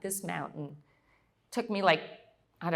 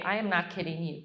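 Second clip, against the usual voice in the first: usual speaking voice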